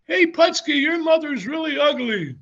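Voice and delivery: loud retold heckle from the stands; heckler's yell. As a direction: imitate a fan jeering at the wrestler. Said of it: "he was yelling things like".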